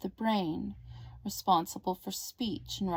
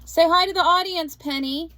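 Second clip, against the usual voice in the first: cheerful coaxing; 9 semitones higher, 9 dB louder than usual. Real, not acted. Coaxing niece to greet viewers.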